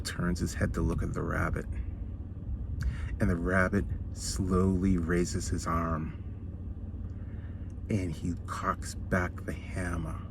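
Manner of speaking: slow it right down and hush for the climax; hushed suspense. A narrator's reading. Teller building suspense before the punchline, slow and hushed.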